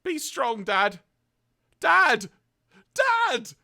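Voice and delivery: high, loud cries building to a shriek; Daniel. Acted. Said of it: "then Daniel responds with".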